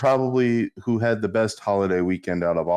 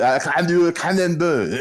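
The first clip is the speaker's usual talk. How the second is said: higher, exaggerated French accent; thick French accent; 7 semitones higher, 3 dB louder than usual. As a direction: imitate a heavily accented French student. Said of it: "he was thick with it".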